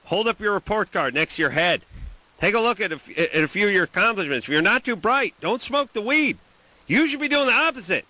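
high, ringing rant to the audience; mock motivational speech. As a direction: deliver a mock lecture, pitched well up. Delivers a rousing mock speech.

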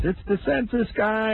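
high mock yell from below. Census guy yelling up to the window. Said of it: "And he yells".

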